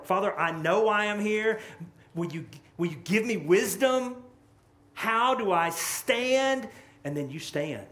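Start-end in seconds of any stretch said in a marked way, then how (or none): 0.0-6.7 loud, high cry to God, slowing at the end; pleading prayer. Pretend. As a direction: beg the Father for wisdom, louder and higher. Voicing a desperate prayer.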